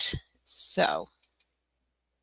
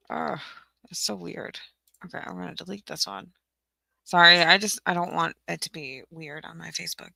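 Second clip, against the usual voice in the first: normal talking voice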